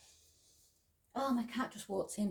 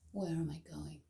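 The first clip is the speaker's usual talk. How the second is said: quiet meditative chant; soft mantra; 10 dB quieter than usual. Acted. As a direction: murmur the mantra gently, breath between. Chants mantra during practice.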